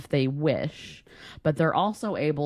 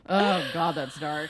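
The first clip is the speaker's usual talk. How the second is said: ordinary delivery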